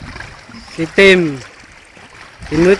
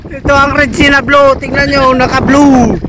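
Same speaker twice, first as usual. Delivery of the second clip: ordinary talk, nothing marked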